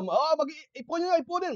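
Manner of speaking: high call to gather up the palay. Retold or imitated. Elder calling workers in to gather the rice, high call.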